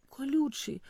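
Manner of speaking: ordinary delivery, nothing marked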